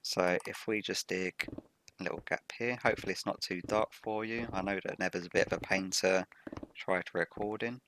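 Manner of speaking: ordinary delivery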